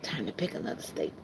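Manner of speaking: breathy whisper of the same line; hushed murmur, almost no voice. Shown as a demonstration. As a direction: whisper it to yourself as an aside. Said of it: "repeated line".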